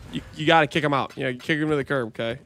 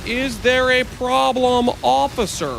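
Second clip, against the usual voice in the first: higher, slow, innocent meme voice; mock innocent driver; 6 semitones higher, slower than usual. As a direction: play the guilty driver acting innocent. Retake of the cop meme.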